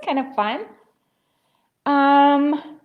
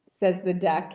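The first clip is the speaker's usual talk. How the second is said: ordinary delivery, nothing marked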